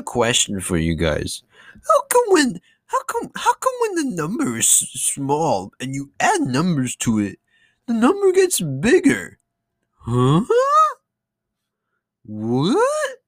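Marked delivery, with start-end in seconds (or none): 1.9-13.2 high, squeaky mock-confused voice; mock bafflement. Vlogger mocking vote complaints, squeaky faux-confused. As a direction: play dumb about numbers going up, sarcastic.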